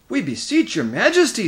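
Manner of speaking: high, loud plea; beseeching. A narrator's reading. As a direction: plead urgently with an angry king. Lord pleading with raging king.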